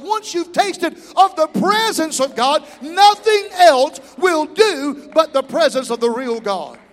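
rising from strong to full shout; building preacher shout. Real, not acted. Preacher building the congregation up to shouting.

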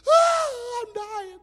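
high mock wail, then trailing off; mock panic. Pastor imitating a woman sure she is dying, hammed-up panic. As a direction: ham up a dramatic panicked cry.

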